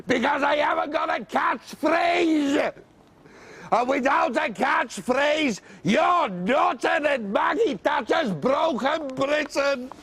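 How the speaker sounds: high, shouting comedian; ranting shout. Acted. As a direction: bellow it out at the wife. Comedian rants at wife.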